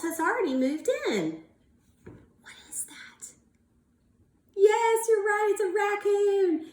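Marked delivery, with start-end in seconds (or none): 2.0-3.3 hushed mysterious whisper. Acted. Teacher to class, a suspense whisper. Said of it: "asking class in a whisper". 4.6-6.7 high, loud singsong yes; excited praise. Real, not acted. Teacher praising class, a sung excited yes. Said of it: "praising a class answer".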